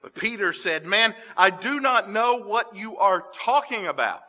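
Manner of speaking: steady, solemn Bible reading; scripture reading. Acted. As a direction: tell the courtyard scene gravely. Reads the denial passage.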